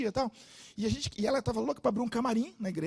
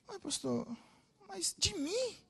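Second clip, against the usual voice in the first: softer, high unsure question; timid disbelief; 11 semitones higher, 10 dB quieter, slower than usual. Retold or imitated. Girl on the verge of tears asking the pastor, timid. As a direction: ask it as if it can't be true.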